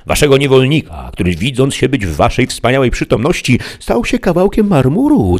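a servant pleading grandly on his knees; kneeling squire heaping praise on a peasant girl; Sancho kneeling. A narrator's reading. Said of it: "padł na kolana i rzekł".

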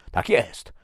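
ordinary delivery

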